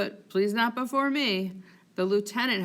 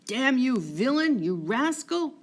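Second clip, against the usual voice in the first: angry shout; 5 semitones higher, 4 dB louder than usual. Acted. Cassio chasing Rodrigo, furious.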